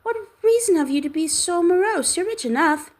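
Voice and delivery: high playful mimicry of the uncle; gaily. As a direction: turn his words back on him cheerfully. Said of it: "returned the nephew gaily".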